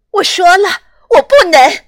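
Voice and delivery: loud, high outburst; angry shout. Acted. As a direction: shout it in despair. Elsa bursts out emotionally.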